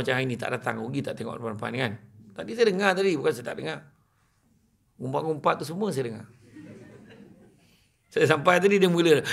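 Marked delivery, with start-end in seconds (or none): none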